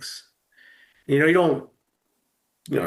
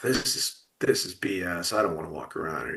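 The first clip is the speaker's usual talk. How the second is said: softer, hurried grumble under the breath; muttered under breath; 5 semitones lower, 6 dB quieter than usual. Retold or imitated. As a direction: grumble it to yourself, fast and hushed. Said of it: "I'm muttering under my breath".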